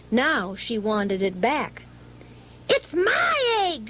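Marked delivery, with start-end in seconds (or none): 2.7-3.9 much higher, indignant sputter; sputtering bird. A narrator's reading. Maisie demanding her egg back, sputtering high. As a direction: sputter it out in outrage. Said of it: "she sputtered".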